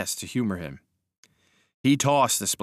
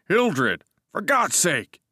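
high, louder alarmed cry; 8 semitones higher, 4 dB louder, much slower than usual. Acted. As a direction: cry out in shock at the knife.

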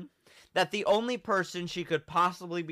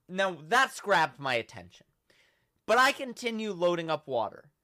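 ordinary talk, nothing marked

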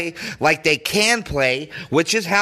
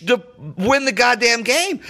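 ordinary delivery

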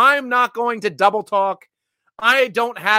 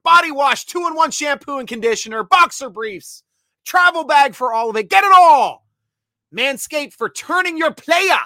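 higher, louder product rundown; hyped ad read; 4 semitones higher, 5 dB louder than usual. Acted. Host selling grooming kit to viewers, hyped ad read. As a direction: pitch it hard, building to a big push.

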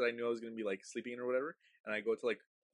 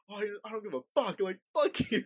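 high, fast mock outburst; acted-out cursing with a laugh breaking in; retold defiant insult; 7 semitones higher than usual. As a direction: sputter high defiance at a ghost. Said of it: "I was just insulting it".

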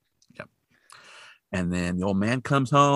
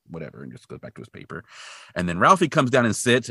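usual speaking voice

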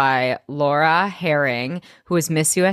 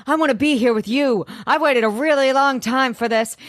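high, fast swooning lover voice; breathless lover; 7 semitones higher than usual. Acted. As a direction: gush it out like a melodramatic heroine. Recapping a love scene in character.

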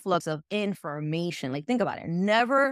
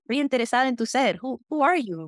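higher, louder, eager curiosity; keen interest; 6 semitones higher, 4 dB louder than usual. Acted. Stranger interested in who you really are, keenly curious. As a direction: act out keen interest in someone. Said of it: "Versus the other one".

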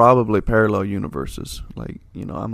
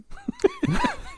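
high laugh; 5 dB quieter than usual. Real, not acted. Laughs at own joke.

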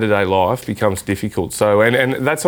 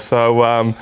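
ordinary talk, nothing marked

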